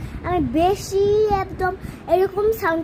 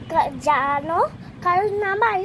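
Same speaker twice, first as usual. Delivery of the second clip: normal talking voice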